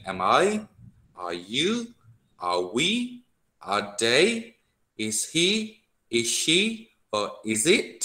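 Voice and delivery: "Am I", "Are you", "Is he", each lifted sharply at the end; question intonation. Shown as a demonstration. Teacher drills question forms for students, rising question tune. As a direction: make each short question climb on its last word. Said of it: "จะต้องมีการเน้นเสียงนิดนึง".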